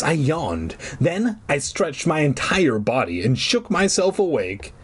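plain reading voice

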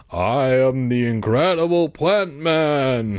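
slow, drawn-out mock-heroic proclamation; hammed-up monster declaration; bold superhero voice. A narrator's reading. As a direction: boom it out slowly like a zombie-like superhero. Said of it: "trying to make his voice sound bold and deep".

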